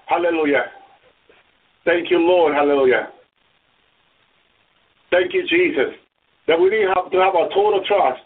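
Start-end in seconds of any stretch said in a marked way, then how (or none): none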